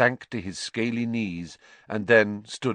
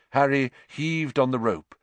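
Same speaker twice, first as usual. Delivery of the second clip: ordinary delivery, nothing marked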